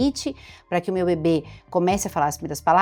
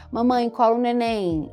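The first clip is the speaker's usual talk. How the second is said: put-on toddler talk; 4 semitones higher, slower than usual. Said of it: "conseguir juntar três ou mais palavras".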